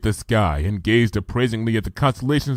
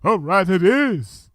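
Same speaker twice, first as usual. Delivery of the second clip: slow, regal verdict; Luna's royal voice; 8 semitones higher, slower than usual. Retold or imitated. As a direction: grant the wish with royal calm. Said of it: "she changed her mind and said".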